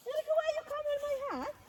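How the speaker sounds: high, quick mock scold; mother playfully scolds her son for stepping into the heart. Real, not acted. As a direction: shoo him out of the heart. Son steps into drawn heart.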